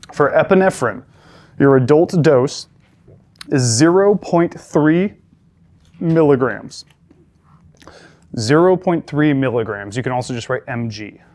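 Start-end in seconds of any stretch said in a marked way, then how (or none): 3.5-6.9 zero point three slow, leaning on zero; stress on zero. Shown as a demonstration. EMT instructor drilling students on the dose, stressing zero. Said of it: "I'm emphasizing the zero". 8.4-10.0 Zero point three milligrams, slower, with Zero leaned on; stress on zero. Shown as a demonstration. Instructor repeating the adult dose to the class, stressing zero. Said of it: "I'm emphasizing the zero".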